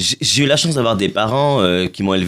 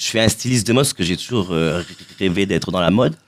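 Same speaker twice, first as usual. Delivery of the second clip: ordinary delivery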